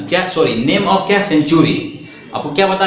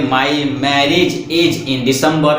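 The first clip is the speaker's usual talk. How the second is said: slow dictation; 2 semitones lower, much slower than usual. Shown as a demonstration. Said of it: "teacher dictates example sentence".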